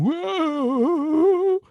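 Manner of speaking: high, loud cheering whoa; crowd whoa. Retold or imitated. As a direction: cheer like a buddy at the ballgame. Buddies at a baseball game.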